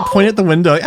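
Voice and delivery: ordinary talk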